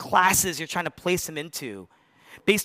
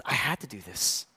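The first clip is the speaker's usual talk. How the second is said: calm Jesus; 7 semitones lower, 6 dB quieter than usual. Retold or imitated. Jesus voiced, calm, unapologetic.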